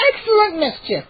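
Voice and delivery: high gleeful gnome cry; delighted gnome squeal. A narrator's reading. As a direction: crow it with delight. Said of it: "Hap cried".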